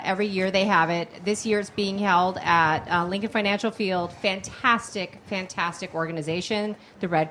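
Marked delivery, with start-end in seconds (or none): none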